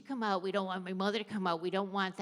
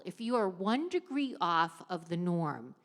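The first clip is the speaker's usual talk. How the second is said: usual speaking voice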